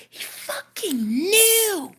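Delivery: high and drawn out, near tears; high, slow, emotional. Real, not acted. Retelling how her partner knew, voice breaking. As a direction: voice cracking with emotion. Overwhelmed by the memory.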